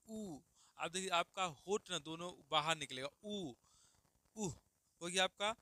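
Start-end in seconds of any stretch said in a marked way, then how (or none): 0.1-0.4 ऊ said with both lips pushed forward; demonstrated oo sound. Shown as a demonstration. Teacher showing students how ऊ is shaped. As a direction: round the lips out for ऊ. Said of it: "बोल, बोल के देखिए".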